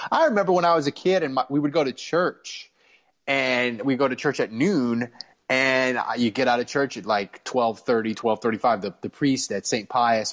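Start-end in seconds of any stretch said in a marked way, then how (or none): none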